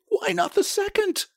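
very high, feverish question. A narrator's reading. Old woman begs for a second wish, feverish. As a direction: push the hope on him urgently. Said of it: "said the old woman feverishly".

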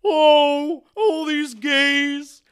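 high, loud, campy diva voice, homophobic [name]; bad [name] impression. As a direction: ham up a mock [name] bitching about gays. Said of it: "My bad [name] impression".

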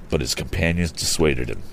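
plain reading voice

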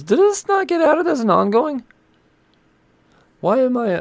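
loud, squealing high disbelief; high-pitched outrage. Real, not acted. Reader finding a series missing from the pull list, shrill outrage. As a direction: shoot way up high in indignation.

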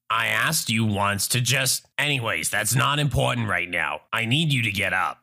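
low-pitched haughty Karen voice; entitled mother. Acted. Entitled mother orders a seated traveler up, low and haughty. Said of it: "Entitled mother sighs".

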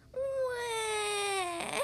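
long, high wavering wail; comic wail. A narrator's reading. Little frog showered with spaghetti, long mock wail. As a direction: draw out a high mock baby cry. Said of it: "wailed Polly".

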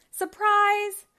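spring the surprise with delight; laughing surprise. A narrator's reading. Wendy greeting Bob at his surprise party, laughing. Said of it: "laughed Wendy".

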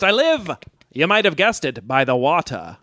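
ordinary delivery

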